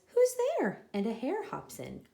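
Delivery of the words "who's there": high, louder squeaky cry; mouse cry. Acted. Mouse hears someone at the den, high startled cry. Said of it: "Mouse cries".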